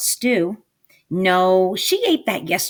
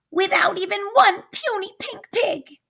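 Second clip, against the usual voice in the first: high, whispered witch lament; hissed witch despair; 13 semitones higher than usual. Acted. Witch despairing over missing pigs, whispered. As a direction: breathe it out in despair. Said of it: "screeched Gritch".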